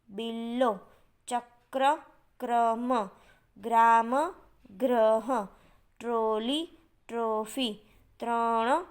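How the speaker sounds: one word at a time, syllables held apart; careful word modelling. Shown as a demonstration. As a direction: pronounce each word deliberately for pupils to copy. Teacher models word list for pupils.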